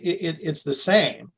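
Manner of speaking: ordinary delivery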